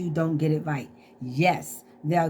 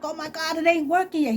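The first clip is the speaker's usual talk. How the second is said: high, anxious wail that it still won't work; mock panic; 11 semitones higher, faster than usual. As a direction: squeal the worry out high. Panicking that the internet is off.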